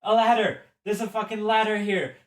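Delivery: high, loud, quick shout; excited discovery. Acted. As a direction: call it out to the others. Character discovers a ladder while fleeing.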